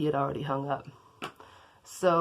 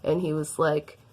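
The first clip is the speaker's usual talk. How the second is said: ordinary talk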